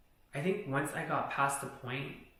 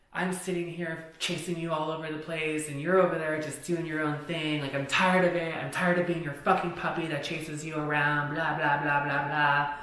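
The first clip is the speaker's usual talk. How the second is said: raised, ranting mock complaint; retold angry yelling; 3 semitones higher, 6 dB louder than usual. Friend griping at her for ignoring him, mock angry yelling. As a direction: imitate his loud tirade, running into blah-blahs. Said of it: "he's yelling".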